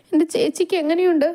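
high, tearful question; tearful worry. Acted. Girl asks doctor about her injured sister, tearful. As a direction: ask anxiously, close to crying.

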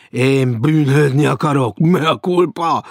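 loud declamation of guilt; tragic cry. A narrator's reading. Stranger beats his breast before Maud, crying out. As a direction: proclaim repentance with tragic show. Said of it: "Kiáltott".

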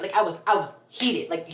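ordinary talk

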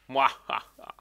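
hammy villain laugh; evil chuckle. Pretend. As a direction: cackle like a cartoon villain. Gloating after levelling up. (böses Lachen)

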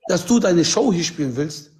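ordinary talk, nothing marked

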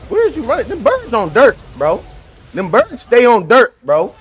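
taunting the fleeing player he is chasing; excited taunt. Real, not acted. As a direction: call out the runner.

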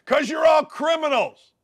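high-pitched shouted accusation; accusing shout. Real, not acted. Host rants at activist groups, accusing shout. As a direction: shout it out as an indictment.

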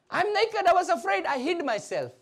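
high start, sinking as he confesses; lightly acted Adam, afraid before God; Adam confessing. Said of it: "what did Adam say?".

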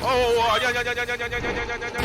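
high frantic repeated no; panicked yelling. Real, not acted. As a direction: scream no over and over. Monster chasing again.

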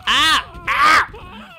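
two high, loud yells; mock screams. Player voicing a figure being hit, loud mock screams. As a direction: yell out high and short.

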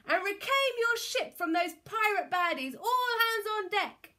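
loud, high captain's orders; rallying cry. A narrator's reading. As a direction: build to a full call. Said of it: "cried Captain Fli".

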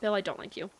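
ordinary delivery

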